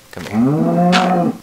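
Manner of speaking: high, loud, stretched call. Pretend. Player summoning a game cat, loud high call. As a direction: call it over, drawing out the word.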